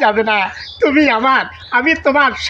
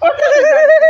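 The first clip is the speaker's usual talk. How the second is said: ওখানে যাবে called out much higher and louder; excited high call; 4 dB louder than usual. Acted. Repeats line much higher.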